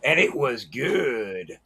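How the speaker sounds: low, slow, soft scripture tone; understated mock solemn close; mock biblical. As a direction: intone like the narrator of Genesis. Biblical joke continued.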